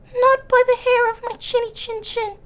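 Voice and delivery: high, squeaky, scared piglet; trembling voice. Acted. Scared pig refuses the wolf, high and trembling. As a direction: quiver but refuse. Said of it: "in a trembling voice".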